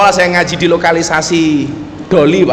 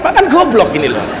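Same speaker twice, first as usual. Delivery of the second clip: ordinary talk, nothing marked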